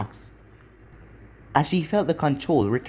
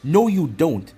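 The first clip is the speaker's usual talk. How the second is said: loud; 6 dB louder, slower than usual. A narrator's reading. Cutting her off, forceful.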